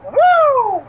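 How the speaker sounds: high, loud Flair-style woo; excited wrestling woo. Pretend. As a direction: let out a big woo. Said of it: "once you start wooing".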